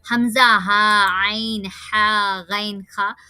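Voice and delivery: slow, deliberate letter names; letters spelled out slowly. Shown as a demonstration. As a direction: say each letter clearly and slowly. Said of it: "ये जो छह हरूफ हमने याद करवाए थे". (धीरे-धीरे हरूफ गिनाना)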